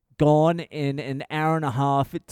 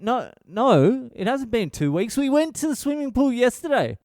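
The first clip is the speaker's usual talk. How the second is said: exasperated correcting; 10 semitones higher than usual. Retold or imitated. Dad correcting his five-year-old, high and exasperated. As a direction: correct the kid in rising disbelief. Answering son's endless questions.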